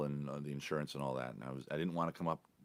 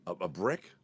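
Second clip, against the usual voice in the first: ordinary talk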